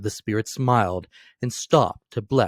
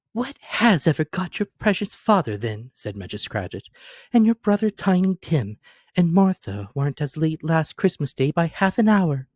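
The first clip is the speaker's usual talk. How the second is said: higher, fretting mother's voice; a mother worried about the late ones, pitched up; Mrs Cratchit fussing; 6 semitones higher than usual. A narrator's reading. Mrs Cratchit asks her children about father, higher and fretful.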